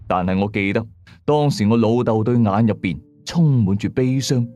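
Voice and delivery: ordinary delivery, nothing marked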